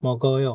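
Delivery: "মগয়" with the g carried into the next vowel; linked pronunciation. Shown as a demonstration. Teacher showing learners where the giyok moves, linked pronunciation. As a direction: run "মগয়" together, final vowel drawn out. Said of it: "এটার উচ্চারণ হয়ে যাবে".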